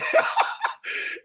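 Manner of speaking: breathy high burst of laughter; a quick wheezy laugh at his own joke; laughing at the hammer and nail line; airy laugh. Real, not acted.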